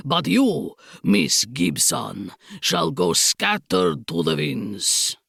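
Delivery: put-on low, slow snarl in Bragislanian accent; snarling Count. A narrator's reading. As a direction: snarl as a foreign villain. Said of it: "he snarled".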